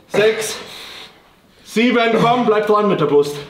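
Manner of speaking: high, loud coaching yells; shouting encouragement. Real, not acted. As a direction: push hard through every rep. Coach shouting reps at lifter.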